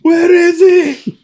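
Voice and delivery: slow, high, loud, strained cry; mock frantic call. Friends retelling a scene, searching loudly, mock frantic. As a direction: call out desperately for him.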